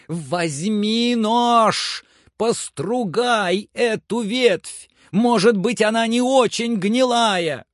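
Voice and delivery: high, drawn-out carrying shout; Legrand shouting orders. Acted. Legrand tells servant to test the branch, high slow shout. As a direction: stretch each order up the tree.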